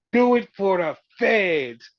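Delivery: a touch of heavy-handed promo drama; dramatic Nigel quote. Retold or imitated. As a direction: lean into the big emotional appeal. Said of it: "He said".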